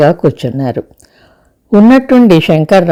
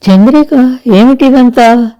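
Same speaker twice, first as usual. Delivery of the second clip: higher, slowed, bewildered question; astonished; 7 semitones higher than usual. A narrator's reading. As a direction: ask in disbelief, drawing it out. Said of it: "విస్మయంగా అడిగింది".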